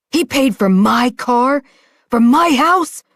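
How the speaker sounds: building fury, climbing into a yell; enraged disbelief. Acted. Father enraged at revelation.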